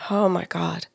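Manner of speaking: hushed relief. Retold or imitated. Client's relieved reaction after breathing.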